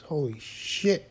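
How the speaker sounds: loud, jolted exclamation leaping high, then settling into a scolding; startled and loud, chiding the person who surprised you; startled outburst. Acted. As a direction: blurt it out like someone just crept up on you. Startled by Richard behind bookcases.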